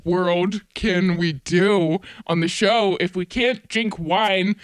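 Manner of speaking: ordinary talk, nothing marked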